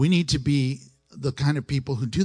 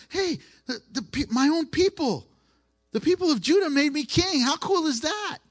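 much higher, excited boastful king; proud David; 13 semitones higher than usual. Retold or imitated. Preacher voicing David praising God, much higher and thrilled. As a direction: pitch David up, bursting with pride.

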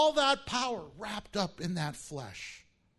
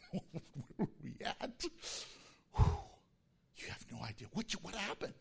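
hushed disciple; 6 dB quieter than usual. Acted. Disciples teasing, secret kept.

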